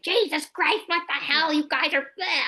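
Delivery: high, exasperated outburst; exasperated mom. Retold or imitated. Mom losing it at kids over lost uniforms, high exasperated outburst. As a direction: let it fly like a mom at the end of her rope. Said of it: "I'm losing my mind".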